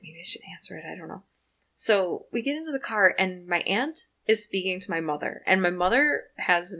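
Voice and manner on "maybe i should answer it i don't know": much softer, unsure; quiet retold musing; retold hesitant aside. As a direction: murmur it doubtfully. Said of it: "And I was like".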